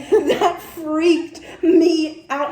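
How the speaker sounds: slow, laughing through the words. Real, not acted. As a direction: let the laugh carry it. Laughing while recounting.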